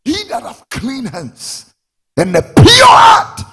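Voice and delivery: slow, high shout building in force; preacher's shout. Real, not acted. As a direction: build to a full-throated pulpit cry. Verse repeated at full force.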